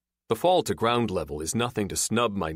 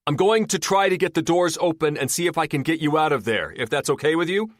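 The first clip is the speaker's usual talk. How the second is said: calling out; 8 semitones higher, 5 dB louder than usual. A narrator's reading. Shouting to trapped woman.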